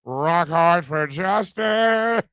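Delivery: slow, drawn-out rallying shout; rally the crowd, stretching each word; mock battle cry.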